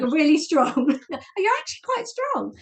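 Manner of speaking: high-pitched, genuinely amazed compliment; impressed coach. Retold or imitated. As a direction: imitate a coach discovering surprising strength, voice leaping up. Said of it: "she didn't say it in a patronizing way".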